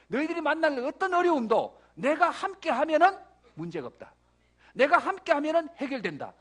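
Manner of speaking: loud, high-pitched sermon proclamation; full-force preaching. Real, not acted. Preacher declaring God's promise to be with them, full force. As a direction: drive every phrase home to the congregation.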